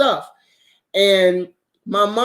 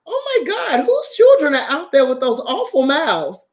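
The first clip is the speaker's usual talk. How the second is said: much higher, prim shocked voice; scandalized bougie lady; 7 semitones higher than usual. Retold or imitated. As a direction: clutch your pearls at the cussing. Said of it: "she's like".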